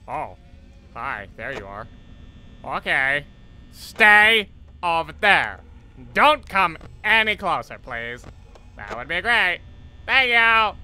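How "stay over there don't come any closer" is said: loud, drawn-out nervous plea; scared pleading. Real, not acted. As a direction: keep the monster at bay. Pleading with animatronic.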